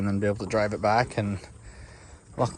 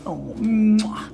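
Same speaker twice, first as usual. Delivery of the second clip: squeaky praising a dog; 11 semitones higher, 5 dB louder than usual. Real, not acted. Kissing the dog.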